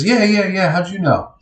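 quick eager kid's reply, lightly imitated; imitated lost kid. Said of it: "Hey, kid, are you looking".

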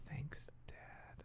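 whispered under her breath. Retold or imitated. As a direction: mutter it under your breath. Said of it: "under her breath".

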